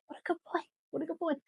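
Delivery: hushed, high praise to a dog; quiet, high pet-praise voice; soft calm praise. Real, not acted. Praising calm dog before release.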